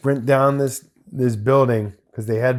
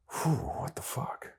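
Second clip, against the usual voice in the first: breathless nervous whisper; 13 dB quieter, slower than usual. Retold or imitated. Nervous sweating on set, inner reaction.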